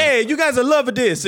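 restaurant man impression. Host playing the man feeding him spaghetti, put-on voice. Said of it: "The man".

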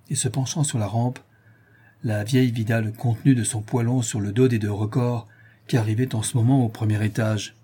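ordinary delivery

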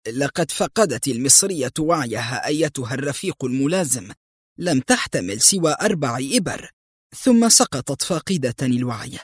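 ordinary delivery

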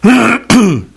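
ordinary delivery

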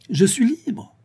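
high, ringing cry; proud exclamation, pitched high; exclaiming. A narrator's reading. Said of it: "s'écria-t-elle en se dressant".

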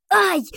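sharp high yelp; cry of pain. Acted.

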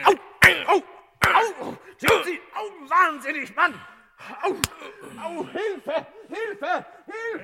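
high yelps of pain then cries for help; high pained yelps turning to panicked calls for help; crying out in pain. Acted. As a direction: yelp and call for help as blows land. Director beaten, cries for help.

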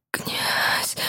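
halting, breathy whisper of a name; breathless whisper. Acted. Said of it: "шептала сбивчиво".

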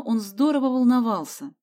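ordinary delivery

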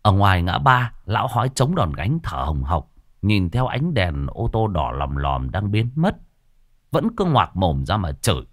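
ordinary delivery, nothing marked

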